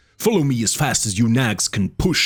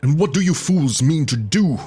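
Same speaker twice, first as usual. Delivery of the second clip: demanding; 7 semitones higher than usual. A narrator's reading. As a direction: demand it of the fools. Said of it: "demanded the Cimmerian".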